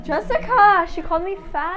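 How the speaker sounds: high, loud outraged gasp; shocked tattling. Real, not acted. Sister's whispered insult overheard.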